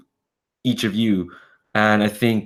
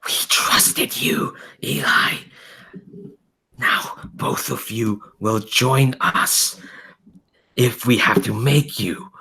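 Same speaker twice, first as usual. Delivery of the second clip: slow, harsh stage whisper; menacing low voice; 2 semitones higher, slower than usual. Acted. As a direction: hiss it like an accusing horde of spirits. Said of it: "this low voice just going".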